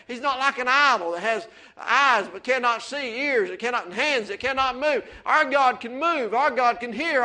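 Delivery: fiery pulpit preaching; preaching at full force. Acted. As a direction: build the sermon up to a ringing shout. Preacher building on God's power.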